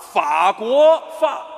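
法国话 very slow and high, ending on fa; garbled pronunciation. Acted. Teacher struggling to copy the correction, slow loud garbled 法国话. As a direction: labour loudly through 法国话, botching hua as fa. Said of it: "你想说法国话吧".

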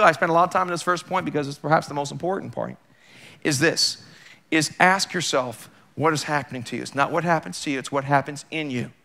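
ordinary talk, nothing marked

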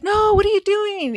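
high, loud startled no; startled refusal of a dare to jump in; alarmed refusal. Retold or imitated. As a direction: push back in alarm. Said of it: "And I was like".

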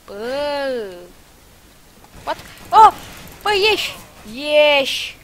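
loud high yell at the enemy; frantic gamer yell; shouting. Real, not acted. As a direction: shout get out. Yelling at enemy in game.

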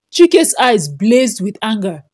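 ordinary delivery

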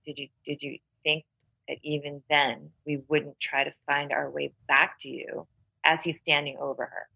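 ordinary talk, nothing marked

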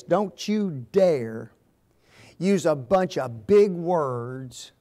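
slow, deliberate, each word weighed; stern warning. Retold or imitated. Training school teacher warning preachers, slow and stern. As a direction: lay down the rule slowly like an instructor. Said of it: "they told us in preacher training school".

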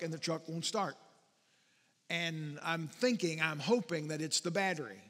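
normal talking voice

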